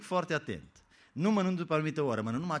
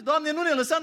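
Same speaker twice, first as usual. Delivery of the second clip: panicked prayer; 11 semitones higher, 8 dB louder than usual. Acted.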